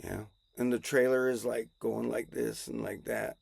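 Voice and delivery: ordinary talk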